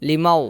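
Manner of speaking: লিমাও sounded out for learners; লিমাও with a clear final au; Malay word limau. Shown as a demonstration. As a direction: say লিমাও clearly, lee-mau. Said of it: "যেমন".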